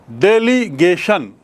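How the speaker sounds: डेलीगेशन slowly broken into four beats; syllable by syllable. Shown as a demonstration. Teacher showing four vowel sounds, डेलीगेशन in separate syllables. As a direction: set each syllable of डेलीगेशन apart. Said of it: "four times".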